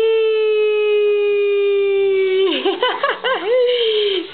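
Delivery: high breaking giggle; real laugh. Real, not acted. Mother laughing at her baby after the rhyme, high giggle.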